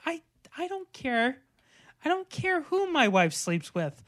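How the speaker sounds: lightly voiced restaurant owner; imitating character. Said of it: "he's like".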